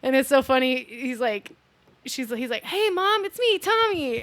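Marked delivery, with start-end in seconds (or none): 2.6-4.2 high, put-on son's voice; fake Tommy impersonation. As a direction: pose as the son greeting his mom. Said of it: "really good at doing impersonations".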